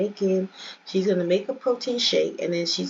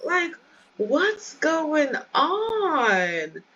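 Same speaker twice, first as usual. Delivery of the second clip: much higher, squealing what's going on; real shock at the game's drama; shocked disbelief; 11 semitones higher than usual. Real, not acted. Gamer shocked to find Stone in a love triangle, squealing high.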